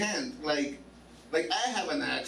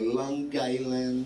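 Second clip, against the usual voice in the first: Long Island said slow, Jersey style; New Jersey pronunciation; 4 semitones lower, much slower than usual. Shown as a demonstration. Comic imitating how New Jersey people say Long Island, drawn out slowly. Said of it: "That's how they say it".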